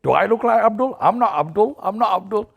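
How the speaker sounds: mock Englishman. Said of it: "he goes".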